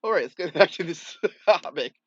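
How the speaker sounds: loud laugh mid-sentence. Real, not acted. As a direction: laugh it off and carry on. Laughing off the bug.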